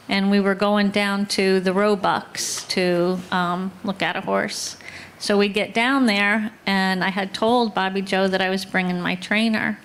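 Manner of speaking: ordinary talk, nothing marked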